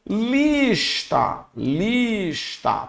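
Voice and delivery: "lista" with a long "lis"; stretched demonstration. Shown as a demonstration. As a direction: draw out the S-T join in "lista". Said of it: "tá vendo lista aqui".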